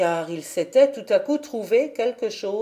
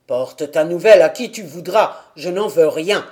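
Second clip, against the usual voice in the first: put-on terse, dry old man, louder and lower; curt old man; 4 semitones lower, 5 dB louder than usual. A narrator's reading. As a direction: brush her off coldly. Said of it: "répondit le vieux sèchement".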